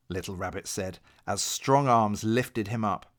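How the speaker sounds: plain reading voice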